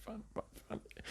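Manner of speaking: fading into a whisper. Real, not acted. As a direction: breathe it out under your breath. Finding his watch, repeating.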